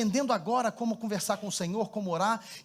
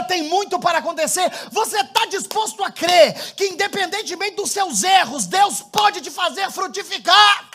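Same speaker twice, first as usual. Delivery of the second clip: louder and higher, building to a shout; preaching at full force; 6 semitones higher, 10 dB louder than usual. Acted. Preacher shouts his altar call over the music. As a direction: call the whole church to believe.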